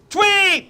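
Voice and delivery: high chirped "Tweet!" like a bird. Shown as a demonstration. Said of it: "let's go".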